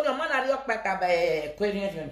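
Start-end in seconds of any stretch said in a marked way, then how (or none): none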